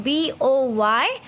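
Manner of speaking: put-on slow dictation of the letters of BOY; spelled out slowly. Shown as a demonstration. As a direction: spell it out for the children to copy. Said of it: "cloud of common noun".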